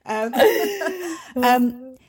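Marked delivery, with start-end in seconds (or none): 0.4-1.3 high, loud burst of laughter. Real, not acted. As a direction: squeal of a laugh. Laughs after calling dog.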